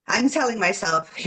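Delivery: normal talking voice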